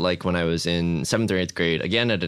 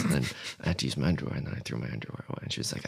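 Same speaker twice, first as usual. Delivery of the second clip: quiet; 8 semitones lower, 9 dB quieter, much faster than usual. Real, not acted. Embarrassed aside after confession.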